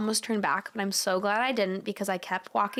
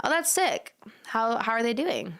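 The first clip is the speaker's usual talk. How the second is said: ordinary talk, nothing marked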